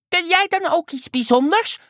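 very high, squeaky question; curious little creature. A narrator's reading. Little creature asking the frog for a trick, squeaky. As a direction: ask in a tiny high creature voice.

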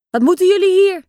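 put-on a bully chasing little kids off; rough challenge. A narrator's reading. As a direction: hostile call. Older boys confront the children.

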